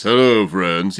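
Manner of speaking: low, mock-manly greeting; manly deep voice. Co-host mimics his friend's failed announcer impression, manly deep voice. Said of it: "you went to your manly".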